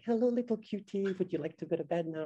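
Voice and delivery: put-on high, sing-song baby talk to a small child; motherese. Shown as a demonstration. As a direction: coo it sweetly like a parent putting a toddler to bed. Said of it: "talk to children in a very special voice".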